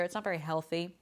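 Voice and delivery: ordinary talk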